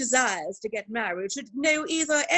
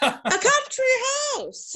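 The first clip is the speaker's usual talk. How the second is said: astonished; 14 semitones higher, 4 dB louder, slower than usual. Acted. Surprised at his land.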